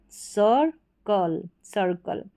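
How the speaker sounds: very slow, drawn-out circle; deliberate teacher demo of circle; slow model word. Shown as a demonstration. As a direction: stretch circle out so students can repeat it. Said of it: "circle क्या है".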